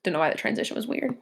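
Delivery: soft unvoiced whisper to herself; quick whispered mutter; whispered aside. Real, not acted. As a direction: breathe it out as a private aside. Muttered aside about video edit.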